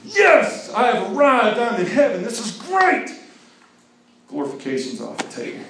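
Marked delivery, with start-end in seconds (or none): 0.0-3.1 high, loud overjoyed cry; ecstatic. Acted. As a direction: exult as if you've reached heaven. Voicing glorified joy.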